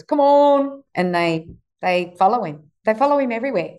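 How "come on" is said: drawn-out call, pitched up; imitated cattle call. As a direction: call the herd in, higher and held. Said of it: "my son just goes".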